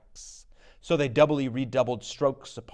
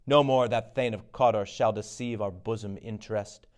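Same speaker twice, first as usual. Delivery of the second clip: ordinary talk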